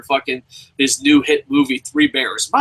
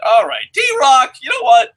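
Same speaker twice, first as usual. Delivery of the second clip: loud, high, slow call-out; mock call-out; 9 semitones higher, 7 dB louder than usual. Real, not acted. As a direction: shout at the chatter in play. Mock callout to chatter.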